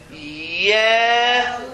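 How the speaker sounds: Yeled drawn out, syllable by syllable; teacher sounding out Yeled; Hebrew word read out. Shown as a demonstration. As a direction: read Yeled slowly as a model. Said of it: "Go to the second word".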